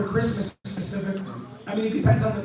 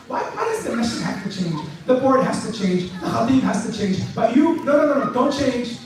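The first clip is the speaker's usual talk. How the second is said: mock outrage, higher and emphatic by the end; sarcastically scandalized, building to higher-pitched exclamations; sarcastic; 3 semitones higher than usual. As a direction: feign alarm at the very idea of changing. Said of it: "I'm being sarcastic".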